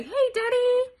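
high, bright call into the phone; cheery greeting. Pretend. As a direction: greet Daddy with big delight. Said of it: "on my phone and say".

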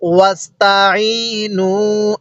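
ওয়াস্তাইনু drawn out slowly, each sound shaped with care; slow Quran recitation. Shown as a demonstration. Teacher showing students how to read the verse, slow careful recitation. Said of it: "কিভাবে পড়ব?".

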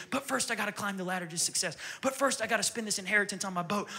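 normal talking voice

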